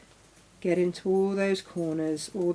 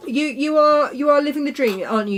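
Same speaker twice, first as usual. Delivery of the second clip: playful teasing; 5 semitones higher, 7 dB louder than usual. Real, not acted. Teasing co-host about teeth.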